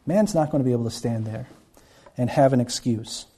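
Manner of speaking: usual speaking voice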